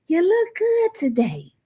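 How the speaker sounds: high, drawn-out admiring compliment; Addie. Retold or imitated. Host quotes a friend to viewers, high drawn-out imitation. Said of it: "And as Addie would say".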